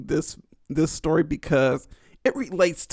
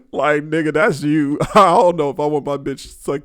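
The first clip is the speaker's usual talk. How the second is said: normal talking voice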